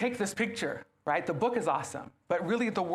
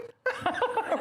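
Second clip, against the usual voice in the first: laugh. Real, not acted.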